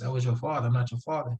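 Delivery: ordinary delivery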